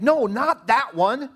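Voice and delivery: high, loud, slow scolding; fussing dad. Retold or imitated. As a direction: nag the kid over the wrong berry. Fussing at son picking strawberries.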